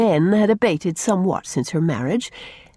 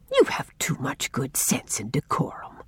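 whispering; 5 semitones lower, 7 dB quieter than usual. A narrator's reading. Servant gently scolding her mistress.

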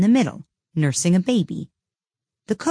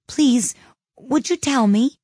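timid; 8 semitones higher, 4 dB louder, slower than usual. A narrator's reading. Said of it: "said Alice a little timidly".

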